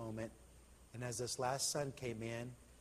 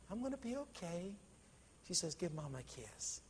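soft reassurance; 4 semitones higher, 7 dB quieter than usual. A narrator's reading. Dying mother comforts crying daughter.

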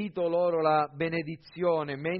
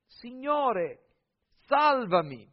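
raised, high-pitched cry to be saved; narrated shout, higher and pleading; desperate cry for help; 9 semitones higher, 5 dB louder, slower than usual. A narrator's reading. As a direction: call out as if sinking. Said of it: "cominciando ad affondare gridò".

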